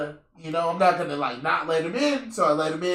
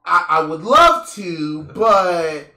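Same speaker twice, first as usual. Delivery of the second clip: louder, stammering fake excuse to a friend; mock lame excuse; 6 dB louder, slower than usual. Dodging his friend's workout invite with a stammered, loud fake excuse. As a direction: fumble for a bad excuse, a bit overplayed. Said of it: "I'm like".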